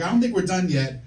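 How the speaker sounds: loud, high, hammed-up pleading; insistent protest. Patient to doctor who says exam's over, hammed-up insistent protest. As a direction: push back loudly, wanting it to go on.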